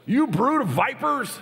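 high, loud, slowed condemnation; brood of vipers outburst. Retold or imitated. John the Baptist rebukes arriving Pharisees, thundering high and loud. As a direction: hurl it at them like a prophet. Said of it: "he looks at them and goes".

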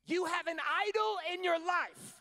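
a father laying down a hard truth; stern father's warning. Retold or imitated. Dad confronting son, retold.

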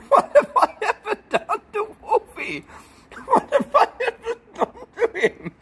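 high-pitched laughing protest; laughing indignation. Real, not acted. Streamer laughing at Wolfie's chat insult, high and squealing. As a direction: squeal it out through helpless laughter.

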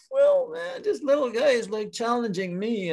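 very high-pitched incredulity, settling down; indignant bear. Acted. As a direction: start high in disbelief, then come down. Bear indignant at being challenged.

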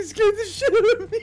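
high, squeaky with laughter; helpless laughing relief. Real, not acted. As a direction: get it out while cracking up. Laughing after a scare.

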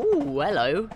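a gently hammed-up thrilled greeting; mock excited. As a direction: imitate your inner train fan perking up. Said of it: "The little veg in me got a little".

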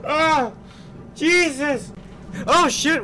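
loud, high yelps after a scare; shocked. Real, not acted. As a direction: exclaim in real shock, pitch way up. Reeling after jump scare.

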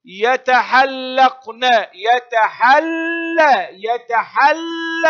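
slow, loud, high يتحل with a long held l; teacher exaggerating the shadda in يتحلقن; doubled lam. Shown as a demonstration. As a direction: hold the l of يتحل, then open into "la". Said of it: "حرف مضاعف ينطق بحركتين".

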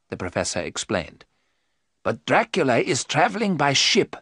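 a hint of a learned old professor, explaining; patient, measured explanation; lightly voiced professor. The professor explaining Dracula's route, measured.

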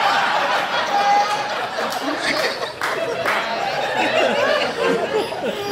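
very high wheezing laugh fit; long high laugh. Real, not acted. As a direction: lose it in a long breathy cackle.

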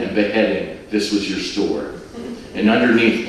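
ordinary delivery

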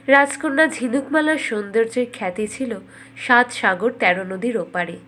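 ordinary delivery, nothing marked

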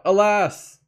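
loud, high anguished cry of Alas!. Acted. Narcissus cries out in grief at his image, loud high wail. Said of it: "when Narcissus cried out".

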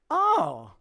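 high surprised oh; a sudden dawning oh; realization. Retold or imitated. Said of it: "He goes".

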